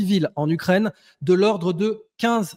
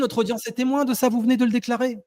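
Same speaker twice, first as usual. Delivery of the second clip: raised pitch, pressing repetition; indignant insistence; 6 semitones higher than usual. Real, not acted. Debater holding opponent to his words before the audience, indignant insistence. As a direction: press the accusation home in a high, insistent voice.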